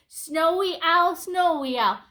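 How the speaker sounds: ordinary delivery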